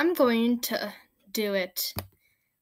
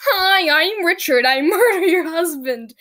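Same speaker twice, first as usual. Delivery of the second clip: mock Richard; 5 semitones higher, 6 dB louder than usual. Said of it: "Anne and him were like".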